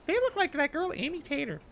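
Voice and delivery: high, thin mock mom voice; imitated mom. Son imitating his mom watching MTV, high teasing voice. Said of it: "and my mom was like".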